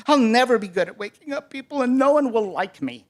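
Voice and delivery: mild self-pitying wail; dejected rooster. A narrator's reading. Rooster despairs of ever learning, mildly wailing. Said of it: "the rooster sighed".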